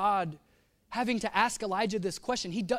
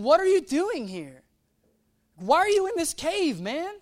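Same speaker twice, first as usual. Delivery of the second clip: raised, emphatic challenge; incredulous, louder and higher questioning; God questioning; 8 semitones higher, 7 dB louder than usual. Acted. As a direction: demand it loudly, pitch swooping high. God questioning Elijah, acted out.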